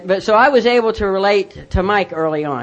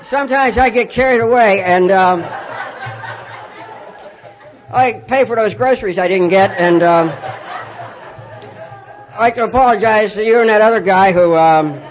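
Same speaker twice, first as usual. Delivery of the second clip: halting, louder public confession; reluctant amends with long pauses; sheepish apology; 2 semitones higher, 4 dB louder than usual. Retold or imitated. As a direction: re-enact an awkward apology in stops and starts. Retold forced apology in the store.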